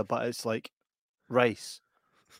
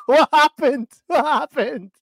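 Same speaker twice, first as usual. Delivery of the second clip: high, loud startled question; mock alarm; 12 semitones higher, 6 dB louder, slower than usual. Real, not acted. Host spotting his friend's missing tooth, high loud startled cry. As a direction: feign shock at the gap.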